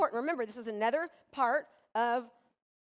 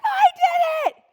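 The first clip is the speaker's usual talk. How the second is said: high, loud, drawn-out cry of triumph; triumphant; 14 dB louder, slower than usual. Retold or imitated. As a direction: burst out with pride. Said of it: "She was like".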